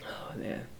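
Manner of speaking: a soft, breathy whisper on the edge of tears; tearful whisper. Real, not acted. Vlogger overcome while talking to his viewers, whispering tearfully. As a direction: let it slip out under your breath, fighting tears. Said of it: "I'm probably about to cry".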